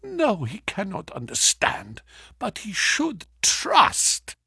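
high, hushed woman's voice; lightly voiced wife, soft and wounded; grieving wife. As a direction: speak softly through her hurt. Wife answers Watson.

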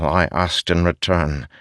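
ordinary delivery, nothing marked

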